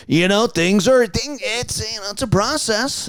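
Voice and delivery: a hint of a heartbroken DJ; sad radio host. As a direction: bravely shrug off a divorce on air. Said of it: "morning radio guy talking about his divorce".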